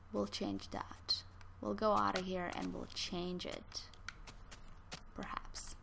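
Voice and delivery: hushed plotting aside; scheming whisper. Real, not acted. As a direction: whisper it like a secret plan.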